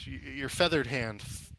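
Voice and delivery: ordinary talk, nothing marked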